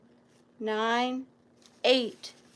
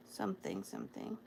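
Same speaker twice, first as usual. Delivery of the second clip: choking up; 12 dB quieter, faster than usual. Real, not acted. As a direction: voice sinking to a tearful near-whisper. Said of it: "I'm not gonna cry".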